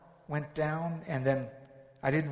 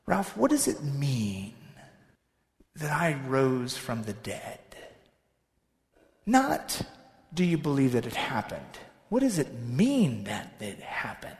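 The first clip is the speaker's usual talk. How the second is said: calm gentle question; 5 semitones lower than usual. Acted. Jesus voiced calm.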